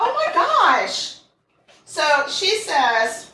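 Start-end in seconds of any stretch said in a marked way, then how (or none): 0.0-1.2 high, slow laughing gasp; laughing disbelief. Real, not acted.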